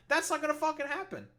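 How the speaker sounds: very high, incredulous dismissal; exasperated, high-pitched flat-out no; emphatic refusal. Real, not acted. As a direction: rule it out with pitch jumping way up. Dismisses rate cut as absurd.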